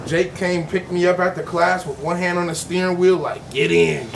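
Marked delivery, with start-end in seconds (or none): 3.5-4.1 creepy low growl. Retold or imitated. Creepy driver voiced in story.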